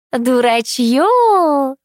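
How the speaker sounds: very slow drawn-out crone scolding. A narrator's reading. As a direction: drag the word out mockingly. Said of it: "протянула торговка".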